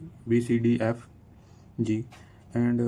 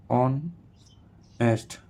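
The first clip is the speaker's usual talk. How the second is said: "Honest" slowly split in two with a pause; syllable by syllable; slower than usual. Shown as a demonstration. Teacher showing a two-syllable word, "honest" syllable by syllable. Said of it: "टू syllable".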